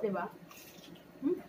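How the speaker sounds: chewing while talking; biting food mid-sentence; mouth full of chicken. Real, not acted. Seen 1: Woman holds chicken to her mouth, biting, while saying words.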